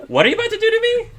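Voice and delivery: very high, fast and frantic; panicked squeak; very worried. Acted. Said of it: "very worried".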